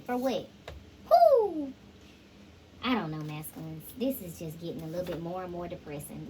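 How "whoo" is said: loud high whoop of delight; excited whoo. Real, not acted. Cheering the feminine on with a loud, high whoop. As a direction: whoop like you're cheering her on.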